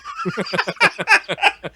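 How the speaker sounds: laughing through sickness; a laugh that hurts; pained laugh. Real, not acted. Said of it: "Oh, don't make me laugh. I'm still sick".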